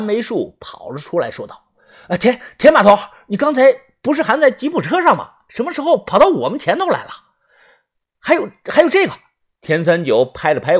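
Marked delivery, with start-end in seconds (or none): 2.1-9.1 high, rushed, stammering surprise; startled questioning. Acted. Narrator running out to question the boss, startled. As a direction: blurt the questions out fast and high.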